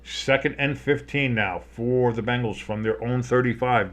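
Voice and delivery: ordinary talk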